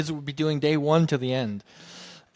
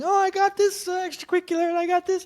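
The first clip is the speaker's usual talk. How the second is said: high, loud sing-song boasting; mock-boastful voice, high and louder; mocking braggy colleagues; 4 dB louder than usual. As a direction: parody smug teachers showing off, pitched way up.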